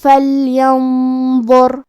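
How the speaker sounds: slow recitation of فَلْيَنظُرْ, lam clear, noon hidden with heavy nasal ghunna before a full-mouthed zo. Shown as a demonstration. Teacher modelling heavy ghunna for students, slow and nasal. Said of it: "غنہ کو بھاری آواز میں".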